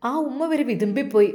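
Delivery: plain reading voice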